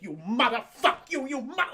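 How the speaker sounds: sputtering tough-guy cursing impression; Joe Pesci. Beating up the scientists, sputtering mock gangster rage. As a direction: curse in stammering bursts, mock rage. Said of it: "It's more like the Joe Pesci".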